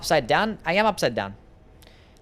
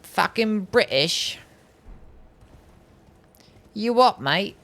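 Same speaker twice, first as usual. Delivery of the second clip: mock British accent; 6 semitones higher, slower than usual. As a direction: tease a chat accusation with a put-on British accent. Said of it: "sound British?".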